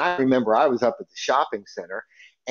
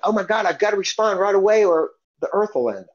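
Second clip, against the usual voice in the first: higher-pitched, exaggerated urgency; mock panic; 7 semitones higher than usual. Mocking people who must answer texts right away, mock-panicked. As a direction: play up a silly emergency.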